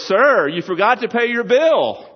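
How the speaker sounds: high, loud, drawn-out waiter call; slow, hammed-up high holler. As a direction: shout after a diner leaving unpaid. Waiter calls after departing rabbi.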